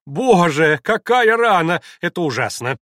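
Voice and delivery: громкий испуганный вскрик, высоко; вскрикнуть при виде крови. Acted. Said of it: "вскрикнула и зажмурила глаза". (испуганно вскрикнув)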